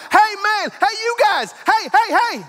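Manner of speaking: high-pitched retold yell; screaming. Stranger running out after the two friends, acted screaming. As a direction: call after them urgently, pitch way up. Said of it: "he's screaming".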